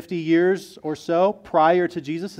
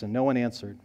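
ordinary delivery

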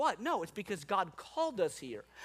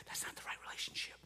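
quick, barely audible whispered warning; 12 dB quieter, faster than usual. Acted. Holy Spirit warning someone about a relationship, whispered. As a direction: whisper it like a voice inside the heart. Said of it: "trying to whisper in our heart".

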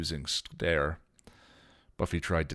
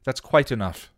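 loud, higher sharp rebuke; with an edge; 7 semitones higher, 10 dB louder than usual. A narrator's reading. Giles silences the arguing friends, sharp edge. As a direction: cut the squabble off cold. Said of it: "an edge to his voice".

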